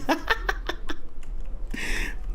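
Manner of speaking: breathy, high burst of laughter; amused laugh. Real, not acted. Vlogger cracking up over a talk-show host's praise, real laugh. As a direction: laugh at your own remark, trailing off. Amused by his own remark.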